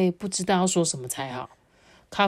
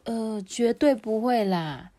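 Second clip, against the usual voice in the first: higher, slow motherly reassurance; lightly voiced reassuring mother; reassuring mom; 4 semitones higher, slower than usual. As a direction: draw it out to soothe the child.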